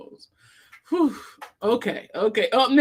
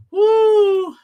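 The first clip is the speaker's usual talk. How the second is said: excited whoop; 8 semitones higher, 8 dB louder than usual. Real, not acted. Hyping up the stream.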